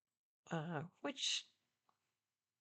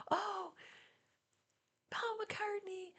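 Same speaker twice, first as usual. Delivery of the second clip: excited fan; 7 dB quieter, slower than usual. Retold or imitated. Young fan spotting her shirt.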